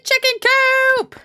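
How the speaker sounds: high screeched war cry; screeching battle cry. Acted. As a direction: shriek it while charging. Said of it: "He screeched as he charged".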